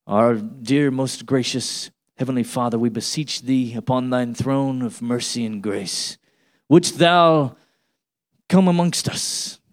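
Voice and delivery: low, stilted, overly formal praying voice; mock a lofty, old-fashioned public prayer; pompous prayer. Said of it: "Nobody talks like that".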